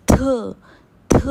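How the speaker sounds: थ with a breath of air through the teeth; airy aspirated थ. Shown as a demonstration. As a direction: model थ with a puff. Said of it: "दांतों से एक हवा सी निकलेगी".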